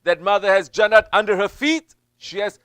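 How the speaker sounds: loud, high, building sermon climax; fiery preaching. Acted. Preacher warning mothers who forbid zikr, loud and building. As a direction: hammer the warning home, building to hell.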